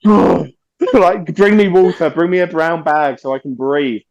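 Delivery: hammed-up, fading request for water; lightly acted breathless diva; mock fainting plea. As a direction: play the swooning fan begging for air. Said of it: "I was just like".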